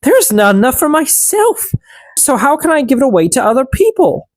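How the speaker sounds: selfish stepsister. A narrator's reading. Stepsister refusing the elves bread, high and haughty. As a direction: refuse them high and snooty. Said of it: "she replied".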